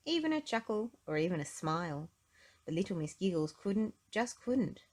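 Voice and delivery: plain reading voice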